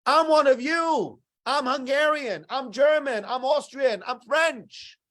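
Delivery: begging them to accept you; pleading insistence. Retold or imitated. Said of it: "you're like".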